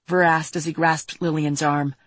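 ordinary delivery, nothing marked

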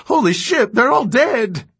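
high, loud panicked cry; horrified shout. Acted. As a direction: yell it in horror. Caleb sees the bodies.

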